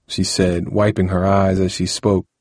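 plain reading voice

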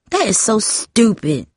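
curt, scornful snap; mild annoyed jab at her sister; snapping. A narrator's reading. Jamie dismisses her sister's hope with a mild snap. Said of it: "Jamie snapped".

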